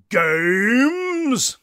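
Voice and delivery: loud, rising theatrical English boom; booming English ghost. A narrator's reading. Ghost's voice from nowhere answering Harry, booming and English. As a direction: boom it out in a grand English accent. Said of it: "a deep, booming tenor with what might have been an English accent".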